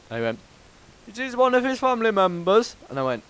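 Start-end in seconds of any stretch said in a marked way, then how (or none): 1.1-2.8 high, louder Indian caller impression; Indian accent. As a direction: mimic an Indian cold caller. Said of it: "'cause it was an Indian bloke".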